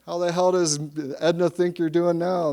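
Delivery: mock heckler yelling. Hecklers on the other bench after a home run, mock yelling. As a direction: imitate a heckler yelling across the field. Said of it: "the hecklers on the other bench are yelling".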